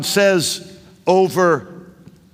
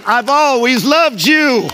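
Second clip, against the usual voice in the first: much higher, louder, slow and drawn out; climactic shout; 6 semitones higher, 4 dB louder than usual. Acted. Preacher declaring God's love as the last answer, full shout. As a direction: proclaim it at full force to the whole room.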